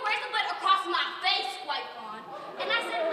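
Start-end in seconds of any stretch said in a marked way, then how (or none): none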